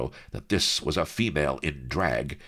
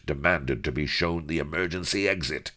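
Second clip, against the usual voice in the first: plain reading voice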